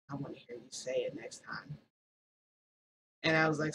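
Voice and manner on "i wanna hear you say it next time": very soft, said close behind someone; low-key close-up tease. Acted. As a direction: say it quietly, just for one listener. He comes up close behind her.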